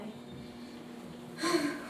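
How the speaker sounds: audible breathy sigh. A narrator's reading. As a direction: sigh out in pity. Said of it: "he sighed".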